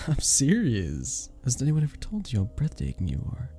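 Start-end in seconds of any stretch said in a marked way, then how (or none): 0.0-1.3 chuckle, then slow, higher-pitched insistence; amused insistence. Acted. Bartender flirting with a disbelieving guest, amused insistence. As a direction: laugh lightly and stretch out the reassurance.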